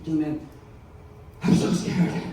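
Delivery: terrified, breath-only whisper at the end; Heather from Blair Witch. Retold or imitated. Scared caller to his friend, mock terrified whisper. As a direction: whisper it in hammed-up horror-film fear. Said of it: "like Heather from the Blair Witch Project".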